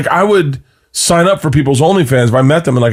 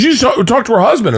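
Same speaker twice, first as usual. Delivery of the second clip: high, quick retold challenge; imitating wife; 9 semitones higher, faster than usual. Wife grilling husband about the husband, lightly imitated. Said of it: "she goes".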